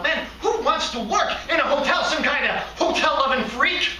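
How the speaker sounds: high-pitched, exasperated protest; indignant outburst. Acted. Receptionist scorning his hotel job, indignant outburst. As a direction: protest as if it's absurd to even ask.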